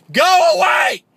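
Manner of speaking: high yelled go away; mock shout. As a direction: shout them off the door. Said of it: "I'm like".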